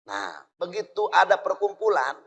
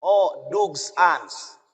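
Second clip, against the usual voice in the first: Dogs Ans drawn out very slowly in a put-on Dutch voice; slow Dutch drawl; much slower than usual. Dutchman tasting young coconut, slow Dutch drawl on Dogs Ans.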